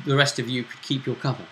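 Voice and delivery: ordinary delivery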